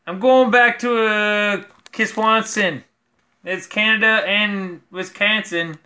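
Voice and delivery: put-on slow, sing-song Wisconsin or Canadian accent, not very convincing. Joking to viewers about Kiswanson in a Wisconsin-Canadian accent. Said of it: "try to do a Wisconsin accent".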